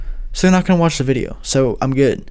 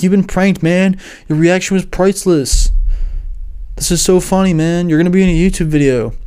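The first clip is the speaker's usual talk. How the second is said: higher, smug teasing YouTuber voice; gloating prankster; 5 semitones higher than usual. Retold or imitated. French YouTuber telling his tutor he was pranked, smug teasing. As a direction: rub it in like a grinning prankster.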